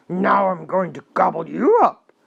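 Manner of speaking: very loud troll threat; roaring troll. A narrator's reading. Troll threatens to gobble the big goat, a very loud roar. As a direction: roar the threat at full volume. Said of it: "roared the troll".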